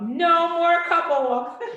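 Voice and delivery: high, loud, slow mock protest chant; kids protesting. Kids protesting the parents' couple walks, loud mock chant. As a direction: chant it like picketing children, laughing. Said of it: "this big sign of protest".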